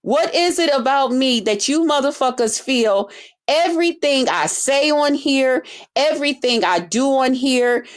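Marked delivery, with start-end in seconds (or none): none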